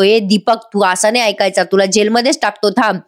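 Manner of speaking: ordinary delivery, nothing marked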